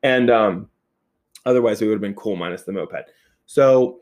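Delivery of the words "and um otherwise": plain reading voice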